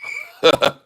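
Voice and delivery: imitating the mother's shocked, breathless laugh; a stunned, airy laugh down the phone; breathless laughter. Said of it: "this is what I heard".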